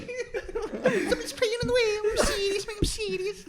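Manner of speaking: high, very slow, laughing Scouse; hammed-up Scouse fan swearing he's serious; Scouse accent impression. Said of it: "Is he from Liverpool?".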